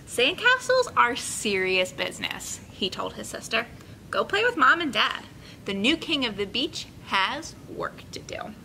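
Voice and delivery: put-on bright, paced read-aloud for kids; story narration. A narrator's reading. Teacher reading a picture book to her class, lively and clear. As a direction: tell the story to a room of children.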